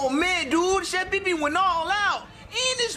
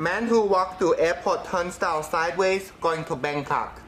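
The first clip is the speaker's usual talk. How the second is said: low proverb in a put-on Chinese accent; mock Chinese accent; 10 semitones lower than usual. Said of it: "my name is Doctor Wong".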